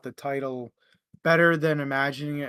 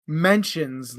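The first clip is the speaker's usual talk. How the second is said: normal talking voice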